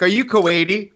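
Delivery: mock threat. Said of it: "I start threatening him".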